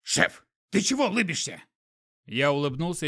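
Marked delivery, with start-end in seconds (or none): 0.0-1.6 puzzled animal sidekick; skunk voice. A narrator's reading.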